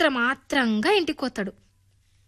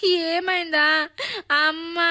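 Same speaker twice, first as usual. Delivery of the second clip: slow weepy; 3 semitones higher, much slower than usual. A narrator's reading. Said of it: "ఏడుచుకుంటా".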